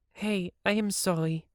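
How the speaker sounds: half-hearted apology to the guard; a light apology. A narrator's reading.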